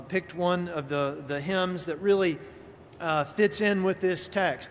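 ordinary talk, nothing marked

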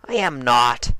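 defensive denial as Dash; mild defensive Dash; Dash denying. Acted. Dash denies.

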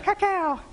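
high nasal-free quack; mama duck call. Retold or imitated. Preacher imitating the mama duck calling her ducklings. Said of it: "however they sound".

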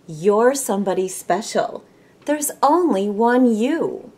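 bright rhyming picture-book reading; reading to children. Acted. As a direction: read it like story time for a class.

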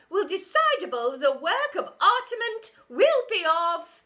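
loud, high grandiose announcement; pompous teacher. A narrator's reading. Teacher announcing to the hall, loud and grand. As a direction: build up to yourself.

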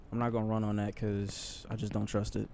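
ordinary talk, nothing marked

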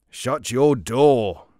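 stern watch captain. Acted. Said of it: "said the captain of the watch".

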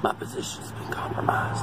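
urgent whisper into radio; hushed, hiding from pursuers. Pretend. Agent hiding in woods.

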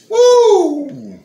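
very high, very loud whoop; excited whoop. Real, not acted. Thrilled by the knife in his pocket, a loud whoop. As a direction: holler with pure glee.

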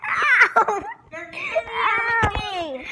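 high laughing yelp. Real, not acted. Hurt while playing.

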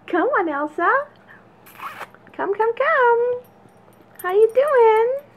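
high, loud, slow coaxing call; coaxing puppy voice. Real, not acted.

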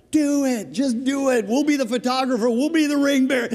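imitating eager sons, very high and loud; excited sons. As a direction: egg him on with hammed-up glee. They're totally in.

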